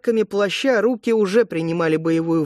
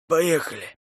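hoarse order. A narrator's reading. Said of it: "севшим голосом приказал".

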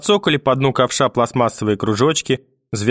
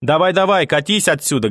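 angry dismissal; 5 semitones higher than usual. A narrator's reading. Grandmother drives mother out.

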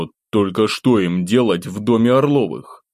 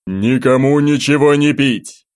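loud, raised commanding boom; booming shout; 6 semitones higher, 5 dB louder than usual. A narrator's reading. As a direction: order the whole hall to stop. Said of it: "громогласно объявил он".

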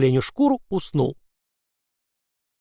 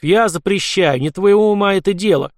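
loud, high commanding shout; angry outburst; 5 semitones higher, 4 dB louder than usual. A narrator's reading. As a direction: shout him down. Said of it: "вскинулся Васильев".

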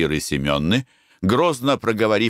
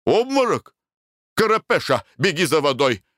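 alarmed; 8 semitones higher than usual. A narrator's reading. Husband alarmed by fainting wife.

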